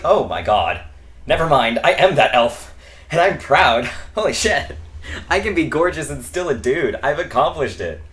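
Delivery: loud, high and proud; elated realisation. Retold or imitated. Realising "I am that elf", loud excited pride. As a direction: burst out with joyful self-discovery, laughing partway.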